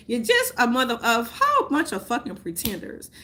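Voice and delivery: usual speaking voice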